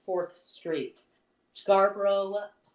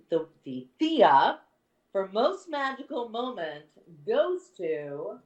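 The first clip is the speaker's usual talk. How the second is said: winner announcement; 4 semitones higher than usual. Acted.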